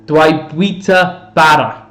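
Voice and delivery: "Dwi bwyta bara" said slowly, carefully in Welsh; Welsh phrase. Shown as a demonstration. Duolingo learner recites Welsh, slow careful. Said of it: "which I think means".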